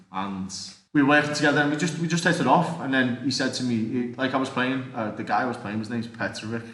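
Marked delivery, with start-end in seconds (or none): none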